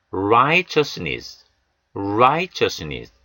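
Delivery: Righteousness stressed on the first syllable, very slow; stress on RIGHT. Shown as a demonstration. Teacher stressing righteousness slowly. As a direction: lean on the righteousness opening. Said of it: "아이의 강세가 옵니다".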